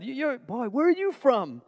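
high-pitched, imitated college classmate; amused classmates. Classmates asking the New Yorker his origins, amused mock question. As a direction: imitate a puzzled Oklahoma student. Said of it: "humorous to them".

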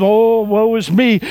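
high, slow self-pitying moan; whiny seed. Acted. Preacher voicing a sad seed, high moan. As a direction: moan like a sorry little seed. Seed voiced in sermon parable.

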